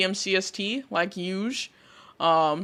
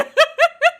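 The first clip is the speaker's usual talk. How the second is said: high squealing laugh; 7 dB louder than usual. Real, not acted. Laughing at chat remark.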